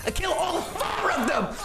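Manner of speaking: high, drawn-out victory cry; triumphant yell. Retold or imitated. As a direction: crow over a gaming win. Reliving a game victory.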